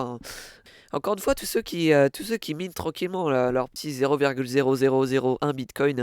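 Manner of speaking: ordinary talk, nothing marked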